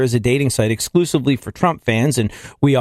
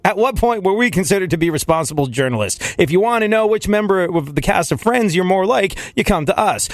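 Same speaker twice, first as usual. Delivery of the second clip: higher, lightly voiced smug website; a hint of a mocking BuzzFeed spokesperson, pitched up; 8 semitones higher than usual. Host mocking BuzzFeed's reply, voice pitched higher. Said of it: "And BuzzFeed went".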